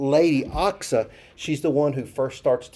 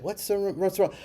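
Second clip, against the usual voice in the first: a mock concerned question, higher and softer; worried asking; 5 semitones higher, 7 dB quieter than usual. Shown as a demonstration. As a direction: ask as if she's upset. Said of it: "it's not like she's crying or upset".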